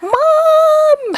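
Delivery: high, long call of Mom across a field; a child shouting for her mother across the soccer field. Retold or imitated. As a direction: call out like a kid from far away. Daughter calling across field.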